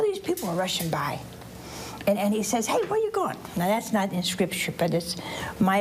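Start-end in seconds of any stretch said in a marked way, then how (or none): 2.7-3.4 higher-pitched call to passers-by; lightly acted, higher-voiced shout-out question; blind man calling out. Blind man asking the crowd rushing by, calling out.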